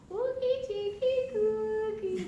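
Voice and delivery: high, loud cooing nonsense; cutesy baby talk. Shown as a demonstration. Teacher coos nonsense to her mirror self, high and loud. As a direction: coo at yourself like a grandmother to a baby. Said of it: "looking at the mirror".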